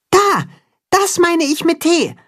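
very high little mole voice; Max, pointing out. A narrator's reading. Mole Max shows the others the letter, squeaky. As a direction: pipe up to point it out. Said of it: "sagt Max".

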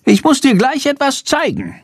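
high-pitched little bat character voice; Shandor the bat. Acted. Bat to boy about his research, high creature voice. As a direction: squeak it out high, as a talking bat.

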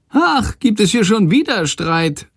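high, squeaky grumble; grumbling bird voice. A narrator's reading. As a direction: grouse like a cheeky little bird. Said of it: "meckert Shandor".

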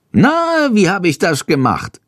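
high, pleased na leading a proud boast; the bird fishing for praise; proud question. A narrator's reading. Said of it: "fragt er stolz". (stolz, mit hohem Na)

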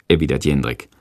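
plain reading voice

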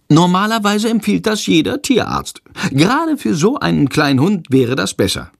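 high-pitched meddling lady; officious woman. A narrator's reading. Woman passenger questions the dog's owners, high and meddling. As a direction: ask it high, as a busybody passenger.

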